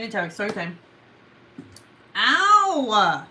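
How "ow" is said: loud, drawn-out "ow"; pained yelp. Real, not acted. Storyteller bitten by her cat, sudden yelp. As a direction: yelp as if nipped on the ankle.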